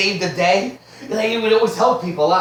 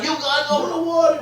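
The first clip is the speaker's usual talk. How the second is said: mock counselor; 8 semitones higher than usual.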